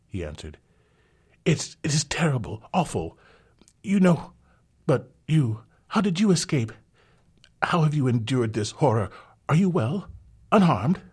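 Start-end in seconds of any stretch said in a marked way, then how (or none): 1.5-11.0 high, loud, breathless worry; frantic concern. Acted. Young man frets over the rescued girl, high frantic worry. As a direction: pour out anxious questions.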